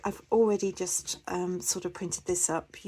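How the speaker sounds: ordinary talk, nothing marked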